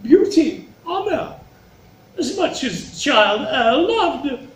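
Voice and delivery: high, drawn-out, swelling declaration of love; grand, slow and loud courtly gush; flattering daughter, gushing. Acted. Daughter flattering the king with love, high gushing.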